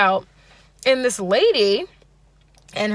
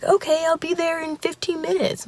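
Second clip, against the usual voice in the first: light mock of an overeager date, pitched high; overzealous Bill Cosby; 9 semitones higher than usual. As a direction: lightly put on an eager man's high voice. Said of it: "overzealous Bill Cosby meets".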